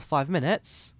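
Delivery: usual speaking voice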